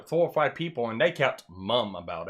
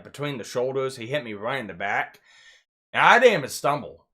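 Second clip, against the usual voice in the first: ordinary delivery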